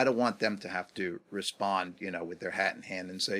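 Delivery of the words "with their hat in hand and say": ordinary talk, nothing marked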